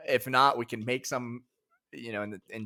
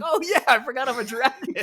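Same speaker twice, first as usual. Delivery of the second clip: laughing; 4 dB louder than usual. Retold or imitated. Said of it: "I was like".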